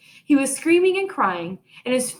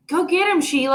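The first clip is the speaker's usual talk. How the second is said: louder, high call to a friend; urgent call; 7 semitones higher, 4 dB louder than usual. A narrator's reading. Peter telling Sheila to chase Fudge, urgent call. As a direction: send her off after him. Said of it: "I said".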